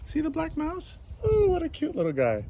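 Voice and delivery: put-on high-pitched baby-talk coo. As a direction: gush over a tiny cute animal. Cooing over a mouse picture.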